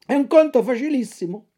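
quick, breezy fox voice; sly fox. Acted. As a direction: brush it off as easy. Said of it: "rispose la volpe".

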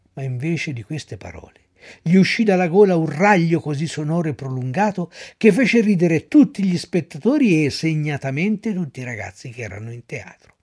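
ordinary delivery, nothing marked

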